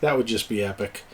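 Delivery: usual speaking voice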